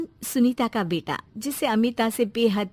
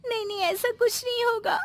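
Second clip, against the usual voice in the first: higher, tearful pleading; crying; 7 semitones higher than usual. Acted. Young mother cries to her mother-in-law, higher and tearful. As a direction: weep through the denial. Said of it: "ये सुनकर रोने लगती है".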